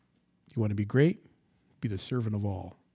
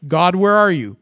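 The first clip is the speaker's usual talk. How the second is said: raised, loud cry to God; loud complaint; 5 semitones higher, 9 dB louder than usual. Acted. Believer demanding where God is, loud acted complaint. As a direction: call out heavenward in mock protest.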